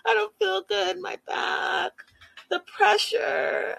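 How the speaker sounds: high, drawn-out whimper; mock whining. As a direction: whimper each complaint like a pitiful patient. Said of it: "she's whining some more".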